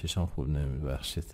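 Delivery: ordinary talk, nothing marked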